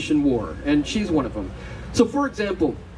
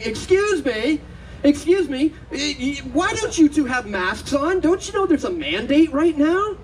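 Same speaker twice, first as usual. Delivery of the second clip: imitating a shouting lady; 6 semitones higher than usual. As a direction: retell the stranger yelling down the hall, pitched up. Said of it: "I hear this shouting".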